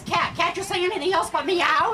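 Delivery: high, loud scolding question; exasperated witch. Acted. Witch fed up with her cat, exasperated and loud.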